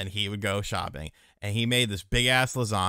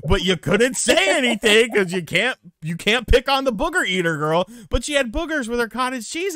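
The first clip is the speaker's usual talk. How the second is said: outraged loud storytelling; 12 semitones higher, 8 dB louder than usual. Real, not acted. Story climax, rising outrage.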